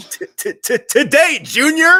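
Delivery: high, loud stammering taunt; mocking stutter. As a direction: stutter then shout the name. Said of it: "and he goes".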